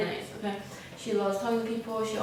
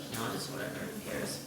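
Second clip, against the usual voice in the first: whispered, lower, who-cares shrug; dismissive whisper; 10 semitones lower, 9 dB quieter than usual. Retold or imitated. She and the cashier shrug off the rude man, a dismissive whisper. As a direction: brush him off under your breath.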